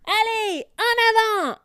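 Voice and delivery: very high, loud charge cry; yell. Acted. Rory charging the hydra, full yell. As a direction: scream the charge forward. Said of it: "hurle-t-elle".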